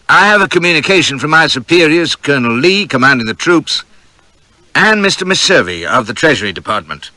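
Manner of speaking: formal Southern officer voice; courteous Southern accent, formal. A narrator's reading. Stewart delivering formal message.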